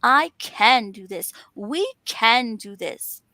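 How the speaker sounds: slow, firm weight on "can"; stress on CAN. Shown as a demonstration. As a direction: affirm it, stretching "can" each time. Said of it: "So instead of saying, "I can't".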